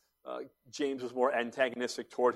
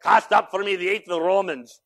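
loud, raised imitation Scottish accent; attempted Scottish accent; 6 semitones higher, 8 dB louder than usual. Preacher copying Ian's line, attempted Scottish accent. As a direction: have a go at a Scottish accent, louder. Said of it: "I want to say this in a Scottish accent".

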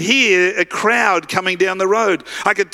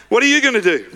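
ordinary talk

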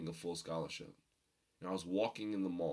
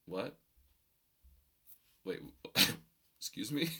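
incredulous; 3 semitones higher, 4 dB louder than usual. Retold or imitated. Retells shock at mom's analogy.

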